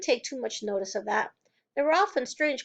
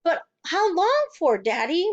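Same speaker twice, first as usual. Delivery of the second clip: slow, high, louder plea; desperate question to father; cried; 6 semitones higher, 4 dB louder than usual. A narrator's reading. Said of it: "Matilda cried".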